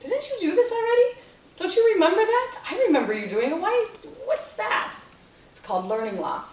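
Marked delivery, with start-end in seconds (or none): 0.0-4.9 high, loud exasperated parent; disbelieving parent scolding. Retold or imitated. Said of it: "you look at their homework and you say".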